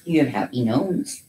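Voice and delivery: ordinary delivery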